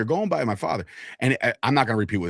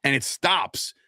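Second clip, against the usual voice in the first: usual speaking voice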